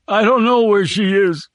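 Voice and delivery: high, broken sobbing plea. Acted. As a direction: sob it out through pain. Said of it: "Lampert sobbed".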